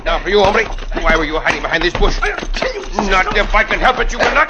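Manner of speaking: loud, high and strained; fierce fight shout. Acted. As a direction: grit it out while grappling. Cisco fights the ambusher.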